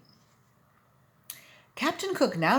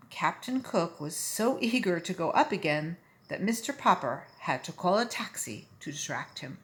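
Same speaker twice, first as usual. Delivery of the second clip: plain reading voice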